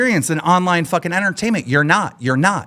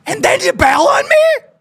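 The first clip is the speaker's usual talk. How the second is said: far higher, yelled with a cry in it; anguished furious cry. Real, not acted. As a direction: break into an outraged wail. Ranting at a guest who bailed.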